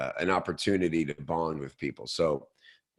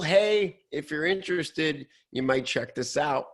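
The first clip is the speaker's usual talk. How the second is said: much higher, light and casual offer; 8 semitones higher than usual. Retold or imitated. Marketer voicing a gentle upsell email, high and easy. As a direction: toss it off as a friendly aside.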